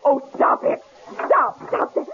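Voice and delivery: rising, breathless protests; panicked pleading. Acted. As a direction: push him away in alarm. Woman fights off the killer.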